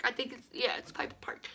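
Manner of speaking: soft whisper, half to herself; whispered aside. Real, not acted. Recalling the park's name in a whisper. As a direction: drop to a whisper while checking the name.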